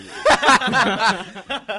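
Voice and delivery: high wheezing laugh; cracking up hard; loud burst of laughter. Real, not acted. Cracks up at the story.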